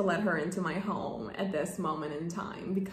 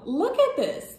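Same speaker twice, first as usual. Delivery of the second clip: excited; 7 semitones higher, 5 dB louder than usual. Real, not acted. Excited showing the letter.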